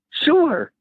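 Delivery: a bright, high, eager yes; eager sure. Retold or imitated. Offered cocaine, answering with a high eager yes. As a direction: jump at the offer, high and keen.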